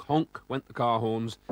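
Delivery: usual speaking voice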